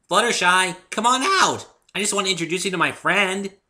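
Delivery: high, loud, bouncy pony voice; high loud cheerful pony girl; bubbly Pinkie. A narrator's reading. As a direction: chirp it like an excitable pony. Pinkie coaxing through door.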